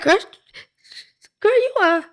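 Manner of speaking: very high, loud excited squeal. Retold or imitated. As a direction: squeal it out, shocked and thrilled. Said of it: "And I'm like".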